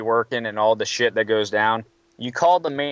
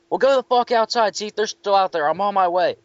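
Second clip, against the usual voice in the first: higher, mock-annoyed orders over the phone; imitating boss on phone; 7 semitones higher than usual. Maintenance guy on the phone to the clerk, higher and mock-annoyed. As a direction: bark it like a fed-up boss.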